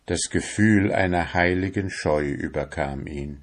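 plain reading voice

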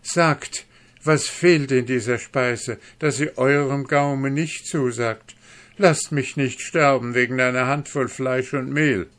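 high, loud cry for mercy; desperate plea. Acted. Dwarf cries to the guest for mercy, desperate. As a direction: beg on your knees. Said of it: "rutschte auf den Knien zu dem Gast".

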